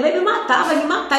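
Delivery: high, panicked cry of fear; retold terrified cry. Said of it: "comecei a gritar".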